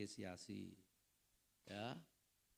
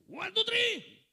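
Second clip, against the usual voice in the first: very loud, high cheer; shout from the stands; 17 dB louder, much slower than usual. Retold or imitated. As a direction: yell down from the stands to the son. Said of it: "Saya teriak dari atas tribun itu". (teriakan dukungan dari tribun)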